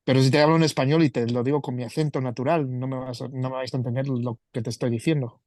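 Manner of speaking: rapid-fire Spanish in his own natural accent; fast natural Spanish. Shown as a demonstration. Showing the podcast host how fast his real Spanish runs. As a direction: rattle the Spanish off quickly, unslowed. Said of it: "if I talk to you in my natural accent".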